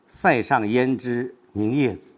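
slow down on 凝夜子 and lean into it; slowed stress. Shown as a demonstration. Teacher showing the stress placed on 凝夜子, slowed. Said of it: "我们把凝夜子 放慢、加重".

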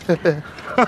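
short pleased chuckle. Acted. Captain chuckles after explaining the other villages' trick, amused. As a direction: laugh briefly at your own answer.

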